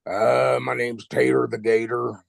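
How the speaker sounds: lightly gruff alligator; gruff gator voice. Shown as a demonstration. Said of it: "kinda down like that".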